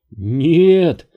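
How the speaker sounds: high, loud cry of refusal; mildly tearful outburst; sobbing refusal. A narrator's reading. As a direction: cry it out in distress. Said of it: "всхлипнула она".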